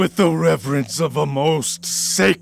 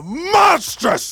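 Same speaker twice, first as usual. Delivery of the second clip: outraged cry; 13 semitones higher than usual. Acted. Jealous husband horrified by the news, outraged cry. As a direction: let the word tear out of you. Othello enraged at the report.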